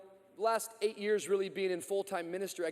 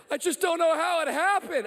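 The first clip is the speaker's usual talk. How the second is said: high, louder pleading teen voice, mildly acted; bewildered teen; 8 semitones higher, 5 dB louder than usual. Seventeen-year-old in the pastor's office, high bewildered plea. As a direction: play the lost kid who can't explain. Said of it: "What you mean you don't know what happened".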